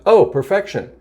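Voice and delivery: bright, lifted "oh, perfection"; a pleased little gasp of "oh, perfection"; delighted realization. Shown as a demonstration. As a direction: discover the word with joy. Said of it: "let's take a spiritual light view".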